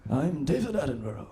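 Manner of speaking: a hint of a hushed British nature presenter; David Attenborough impression. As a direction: softly mimic the nature documentary host. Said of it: "Do you know the Planet Earth guy?".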